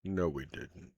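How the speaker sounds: put-on earnest, probing older man; steady, serious inquiry; lightly voiced old hunter. GM plays Van Richten confessing.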